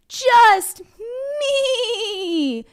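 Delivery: high squeaky little-goat voice, loud; small goat voice. Shown as a demonstration. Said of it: "Do the voice with me".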